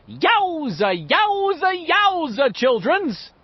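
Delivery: high, stretched-out showman's holler. A narrator's reading. Richie clowning through cupped hands, hammy showman voice. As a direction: whoop it like a hammy bandleader. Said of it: "put on his Ben Burney voice".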